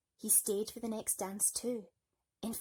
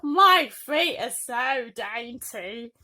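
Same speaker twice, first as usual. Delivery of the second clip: loud, slow smug boast; 3 semitones higher, 14 dB louder, much slower than usual. Acted. Bertha boasting.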